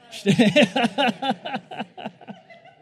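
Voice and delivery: loud burst of laughter; laughing. Real, not acted. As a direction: crack up at yourself. Laughs at own slip.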